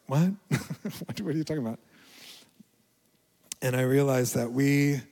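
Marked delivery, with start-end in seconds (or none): 0.1-1.8 high, laughing disbelief; baffled what. Retold or imitated. Pastor recalling his confused reply to God, laughing disbelief. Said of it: "And so I was like".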